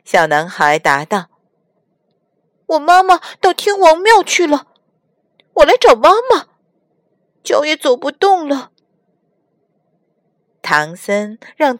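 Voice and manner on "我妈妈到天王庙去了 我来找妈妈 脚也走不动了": put-on high, childlike and a little loud; lost child whimpering for his mother; little boy voice. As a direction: play a tired lost little boy pleading. Little boy answers crying.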